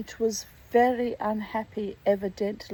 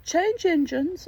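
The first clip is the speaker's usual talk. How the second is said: higher-pitched hoarse character voice; 7 semitones higher than usual. A narrator's reading. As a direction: read it as a hoarse, raised voice. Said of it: "a hoarse voice spoke next".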